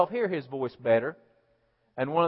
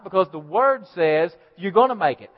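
ordinary talk

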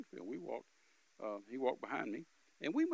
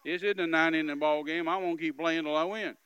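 determined resolve; 2 semitones higher, 11 dB louder than usual. Retold or imitated. Inner resolve, loud.

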